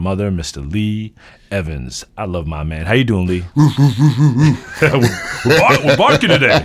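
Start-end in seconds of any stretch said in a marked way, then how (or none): 3.6-6.7 laughing. Real, not acted. Laughing about the bark.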